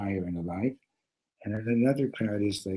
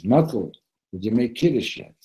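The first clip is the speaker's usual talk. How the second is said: loud, higher prodding question; mock-urgent call to wake a friend; rousing a sleeper; 3 semitones higher, 7 dB louder than usual.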